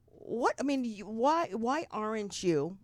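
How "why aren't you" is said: ordinary talk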